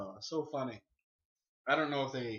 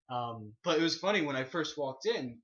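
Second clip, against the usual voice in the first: ordinary talk, nothing marked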